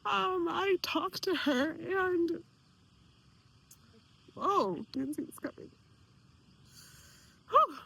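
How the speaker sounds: slow, hushed, fighting back real tears; telling followers about the aunt, voice catching with tears; choked up. Real, not acted. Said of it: "I didn't know this was gonna cry".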